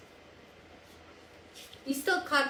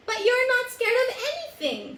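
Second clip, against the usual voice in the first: much higher, lightly voiced cub insisting; a hint of an eager young cub pressing; 10 semitones higher, 4 dB louder than usual. Young Simba insisting to his father, higher. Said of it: "Simba insisted".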